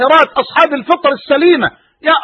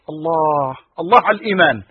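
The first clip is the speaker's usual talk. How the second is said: a low, soft sigh of admiration; soft moved exclamation; 7 semitones lower, 4 dB quieter than usual. Real, not acted. Preacher moved, softly praising faith before his listeners.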